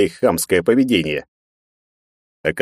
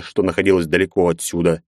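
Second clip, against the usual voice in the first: plain reading voice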